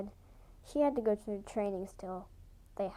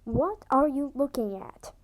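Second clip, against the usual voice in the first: growled; 4 semitones higher, 9 dB louder than usual. A narrator's reading. Tomcat growling at a stranger. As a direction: snarl it at the stranger. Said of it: "he growled".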